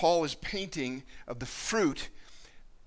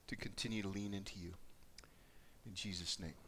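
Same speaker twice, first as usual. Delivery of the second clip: hushed prayer; 6 semitones lower, 5 dB quieter than usual. Acted. Pastor prays before his message, softly and earnestly. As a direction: pray quietly and intimately.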